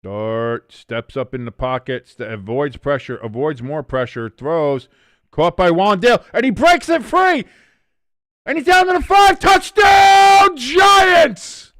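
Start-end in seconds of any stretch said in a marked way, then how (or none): 5.3-11.7 building from a louder call to a much higher, louder shout; touchdown shout. Real, not acted. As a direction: scream the touchdown, stretching the last words.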